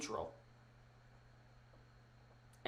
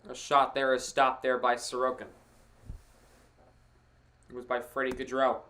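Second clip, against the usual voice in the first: play-by-play; 3 semitones lower than usual. Acted. Live hockey play-by-play.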